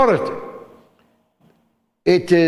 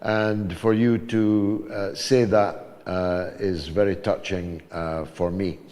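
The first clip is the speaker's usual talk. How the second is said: moved to tears; 6 semitones lower than usual. Real, not acted. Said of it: "You're making me cry".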